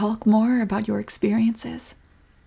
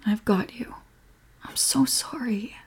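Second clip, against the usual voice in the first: sympathetic whisper; 2 semitones higher, 8 dB quieter than usual. Acted. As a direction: whisper it with sorrow. Comforting upset partner.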